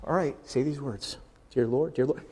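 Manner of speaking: lightly imitated, low and subdued; soft, low recital of a formula; rote prayer leader. Preacher imitates someone leading a sinner's prayer, subdued.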